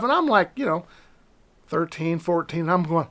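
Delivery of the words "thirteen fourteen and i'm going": normal talking voice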